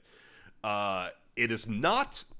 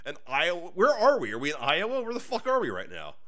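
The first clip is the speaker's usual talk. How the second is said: higher, louder bewildered questions; confused farm guy; 5 semitones higher, 4 dB louder than usual. Retold or imitated. Farm guy in Wolverine wondering where he is, mock bewildered and higher. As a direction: play a lost farm guy unsure where he is. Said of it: "And he's like".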